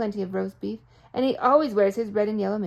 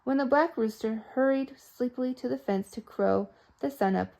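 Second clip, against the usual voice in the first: usual speaking voice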